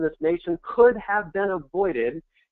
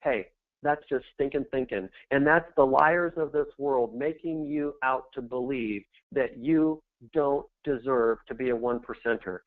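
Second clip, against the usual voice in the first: ordinary talk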